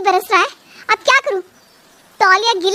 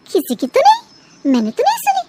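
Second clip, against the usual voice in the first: feigned innocence; 9 semitones higher than usual. Acted. Bhabhi feigns innocence to mother-in-law.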